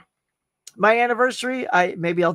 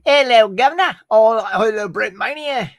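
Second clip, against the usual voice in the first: a quick, hammed-up British greeting, higher and louder; mock British accent; 4 semitones higher, 7 dB louder than usual. Streamer greets a chat viewer in a mock British accent. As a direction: toss off a cheeky mock-British hello. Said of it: "need for doing accents on the fly".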